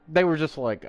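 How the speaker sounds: ordinary talk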